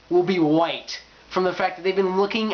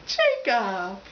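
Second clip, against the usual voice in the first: high whimper into a heartbroken name; mock wailing; 4 semitones higher than usual. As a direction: whine for a lost idol, hammed up. Acting dumped fangirl crying for Jacob.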